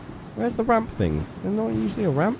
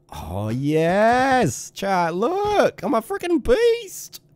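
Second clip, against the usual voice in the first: rising, higher, slower victory brag; showing off to chat, thrilled and triumphant; triumphant whoop; 10 semitones higher, much slower than usual. Real, not acted. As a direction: crow it to chat like a champion. Triumphant after getting cool armor.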